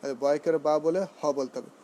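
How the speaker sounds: normal talking voice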